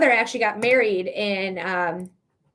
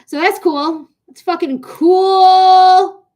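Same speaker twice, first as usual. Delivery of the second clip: loud, slow, higher bitter sarcasm; furious sarcasm at the platform; sarcastic outburst; 6 semitones higher, 9 dB louder, much slower than usual. Real, not acted. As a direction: spit it out like it's anything but cool. Sarcastic after restriction.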